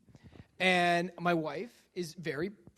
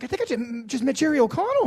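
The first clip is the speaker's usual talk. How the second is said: high, loud, stammering awe; starstruck; 7 semitones higher, 10 dB louder than usual. Retold or imitated. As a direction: blurt it out in disbelief. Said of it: "I'm like".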